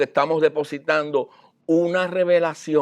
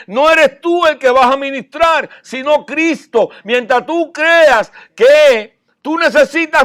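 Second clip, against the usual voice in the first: fiery preaching; 7 semitones higher, 6 dB louder than usual. Acted. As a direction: preach it out with full conviction. Preacher rises to full force. (predicación con fuerza y voz alzada)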